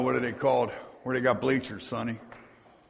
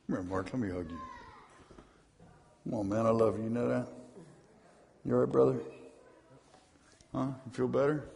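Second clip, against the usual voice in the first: tender aside; 5 semitones lower, 11 dB quieter, much faster than usual. Real, not acted. Pastor hugging man quietly.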